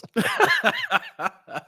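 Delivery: high, loud burst of laughter; shrieking laugh. Real, not acted. Laughing at a viewer's joke, high shriek. Friend's tweet.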